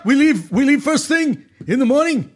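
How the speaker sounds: emphatic, halting stump-speech captain; Bernie Sanders impression. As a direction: lecture the passengers like Bernie Sanders. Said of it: "That's my favorite accent".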